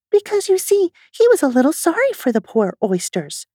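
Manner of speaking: lightly voiced little girl; Alice voice. Alice speaks in story.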